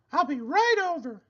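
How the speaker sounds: a llama giving friendly advice; Cherry Sue voice. A narrator's reading. Llama advising her friend the pig, high and sweet. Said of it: "said Cherry Sue".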